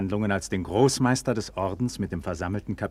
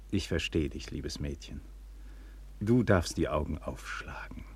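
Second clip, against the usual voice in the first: softer, lower, near whisper; tender hush; 5 semitones lower, 6 dB quieter than usual. Acted. Egmont soothes the bashful Klärchen, almost whispering. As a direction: coax her gently to look up.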